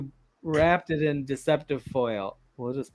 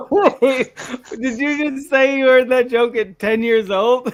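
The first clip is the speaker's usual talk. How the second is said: high, loud question through laughter; incredulous laughter; 8 semitones higher, 8 dB louder, slower than usual. Real, not acted. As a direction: ask in disbelief while cracking up. Stunned listener laughing at punchline.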